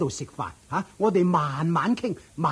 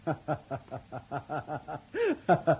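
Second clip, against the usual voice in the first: gloating laugh; 2 semitones lower, 8 dB quieter than usual. Acted. Lord alone after sending them off, gloating. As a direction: chuckle on and on, pleased with the scheme.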